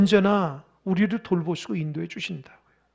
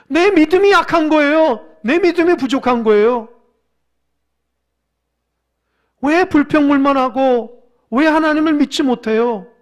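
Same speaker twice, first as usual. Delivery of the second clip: loud, raised, emphatic exhortation; forceful preaching; 7 semitones higher, 11 dB louder than usual. Real, not acted. Preacher admonishes congregation over weak faith, loud and forceful. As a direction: drive each line home at full voice.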